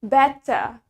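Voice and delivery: "better" as "bet-tah", hard T, no R; British-style "better", R dropped. Shown as a demonstration. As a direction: say "better" with a true T and an open final vowel. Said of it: "Makanya kita sebutnya".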